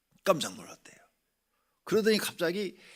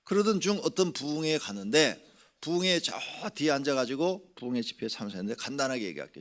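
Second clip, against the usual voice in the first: usual speaking voice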